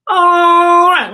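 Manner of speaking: loud, high hype call; rally the kids loudly; excited shout. Acted. Hyping kids for snack check.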